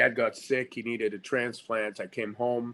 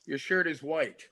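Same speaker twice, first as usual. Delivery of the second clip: flat repeating back and forth; Meisner repetition exercise; 4 dB louder than usual. Shown as a demonstration. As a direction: repeat the line back, listening to your partner. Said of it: "let's do one right now".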